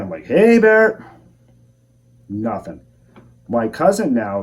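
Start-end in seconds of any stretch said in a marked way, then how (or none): 0.2-1.0 high, loud, drawn-out holler; yelled warning call. Retold or imitated. Hunter warning off unseen eyes in the dark, yelling. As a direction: call out loudly to scare off an animal. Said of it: "Yell out again".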